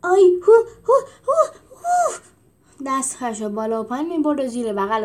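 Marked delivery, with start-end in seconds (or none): 0.0-2.1 rising monkey hoots, each higher; whooping ape calls climbing in pitch; monkey hooting. Acted. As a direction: hoot like an excited monkey. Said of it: "هو کشید".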